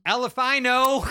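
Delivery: loud, high triumphant punchline; shouted punchline. Real, not acted.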